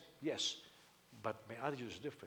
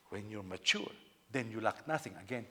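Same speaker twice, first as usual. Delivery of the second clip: ordinary delivery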